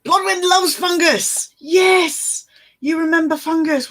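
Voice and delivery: high, excited squeal; gleeful and thrilled; delighted. Real, not acted. Delighted reading comment.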